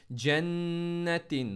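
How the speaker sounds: जन्नतिन drawn out, the न्न held; noon paused and stressed. Shown as a demonstration. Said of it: "नून पर दोबारा pause and stress". (जन्नतिन में नून पर रुककर ज़ोर)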